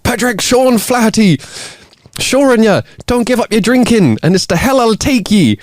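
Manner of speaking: scare him with a high-pitched Irish cry; screaming. Acted.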